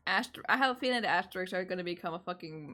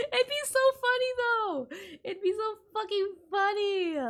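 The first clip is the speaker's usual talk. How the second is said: high giggly laughing voice; 12 semitones higher, 4 dB louder than usual. Real, not acted. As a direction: squeal it through laughter. Cracking up at shirt idea.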